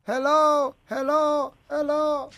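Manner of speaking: fading, echoing hello; like an alien on the radio. Pretend. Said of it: "Se escucha como con eco, como un extraterrestre".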